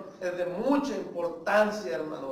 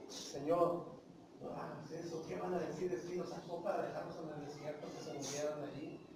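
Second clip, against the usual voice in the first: much softer, low plea; quiet pleading; 4 semitones lower, 10 dB quieter than usual. Acted. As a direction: beg the Lord gently, almost hushed. Moses pleads with God.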